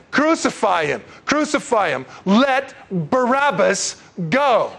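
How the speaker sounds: high chant, slowing to a drawn-out finish; crowd demanding. Retold or imitated. People demand Barabbas's release from Pilate, high insistent chant. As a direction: chant it like a mob, stretching the last demand. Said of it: "And the people said".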